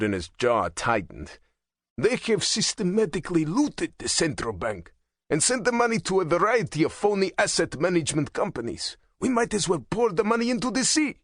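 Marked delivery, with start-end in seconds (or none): 2.0-11.2 high, fast, building anger; rising bitter rant. A narrator's reading. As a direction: rant faster and higher to the end. Shumenko rants, rising fast.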